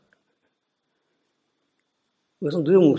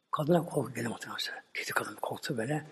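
whisper; 4 semitones lower, 12 dB quieter than usual. A narrator's reading. Narrating the woman's fear.

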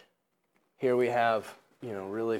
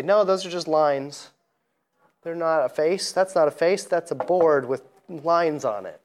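insistent, a bit louder and higher; dismissive quote; 5 semitones higher, 5 dB louder than usual. Retold or imitated. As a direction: brush it off as just lines. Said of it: "people would say".

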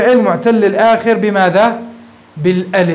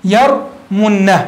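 drawn out slowly; much slower than usual. Shown as a demonstration. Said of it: "teacher models the form".

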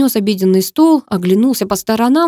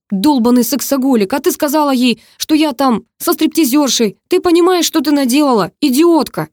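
high, raised angry rebuke; seething fury; 6 semitones higher, 4 dB louder than usual. A narrator's reading. Brother blames his sister for the disaster, high seething fury. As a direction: spit out the blame furiously. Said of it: "посмотрел на меня и процедил".

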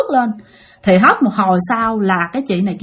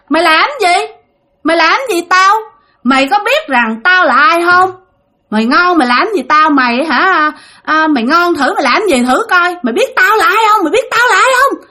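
high, taunting dare; vong thách thức; 7 semitones higher, 4 dB louder than usual. Acted. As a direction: challenge the exorcist, building higher to the end. Vong thách thức ông thầy. (giọng vong cao, hung hăng thách thức)

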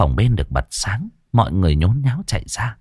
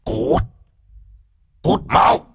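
high, breathy possessed hiss; eerie ghostly rasp; 3 semitones higher, much slower than usual. Acted. Possessed Lan ordering taxi driver Hùng out, eerie hiss. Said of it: "cất giọng ồm ồm".

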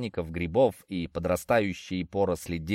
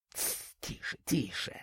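very soft, whispered shushing; urgent hush; 11 dB quieter than usual. A narrator's reading. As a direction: hush her so the giant won't hear. Hushing wife to hide from giant. (тихое шиканье шёпотом)

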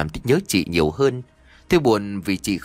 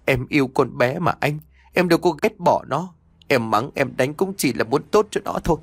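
higher, tearful mother pleading; sobbing plea; 6 semitones higher than usual. Acted. Mother defending herself to her husband, mildly tearful. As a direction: beg through the tears. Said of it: "Mẹ tôi liền khóc nức nở".

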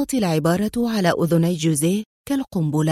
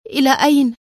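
higher, louder frightened question; alarmed cry; 5 semitones higher, 4 dB louder, faster than usual. A narrator's reading. Mariana cries out to Adham in alarm, higher and louder. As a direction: call out in alarm as he leaves. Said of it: "والجزع يملأ قلبها".

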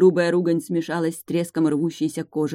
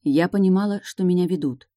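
ordinary delivery

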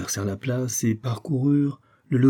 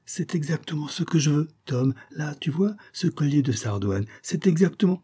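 higher, eager wife's voice; exclaiming; 7 semitones higher than usual. A narrator's reading. Wife pointing out a necklace to her husband, exclaiming. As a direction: point out the very thing you want. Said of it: "s'exclama-t-elle".

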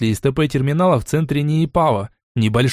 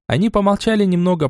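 plain reading voice

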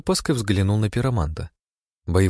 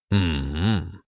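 long closed-mouth "mmm"; drawn-out humming while considering; pensive hum. A narrator's reading. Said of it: "промычал он". (протяжное мычание)

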